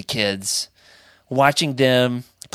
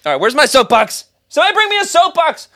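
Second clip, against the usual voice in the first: mock call for a soapbox; 5 dB louder, faster than usual. Demanding a soapbox to rant.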